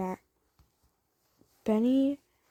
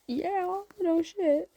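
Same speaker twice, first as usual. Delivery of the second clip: flustered yes; 10 semitones higher, 3 dB louder, much slower than usual. Acted. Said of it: "you're like".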